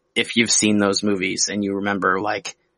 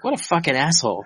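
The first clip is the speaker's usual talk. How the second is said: scornful; 6 semitones higher, faster than usual. Retold or imitated. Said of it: "being like".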